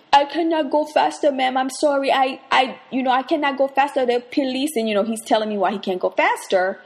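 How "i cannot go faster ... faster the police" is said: taxi driver's excuse. Retold or imitated. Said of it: "And he was like".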